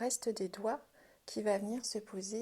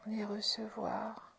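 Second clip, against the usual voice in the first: guided meditation. Acted. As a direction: guide them inward gently, half-whispered questions. Guides a hands-on relaxation. (voix de méditation guidée, douce et chuchotée)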